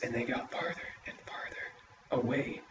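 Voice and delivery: soft, breathy suspense telling; hushed narration. A narrator's reading. Storyteller hushing as the creature fades away, soft. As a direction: drop to a hush for the tension.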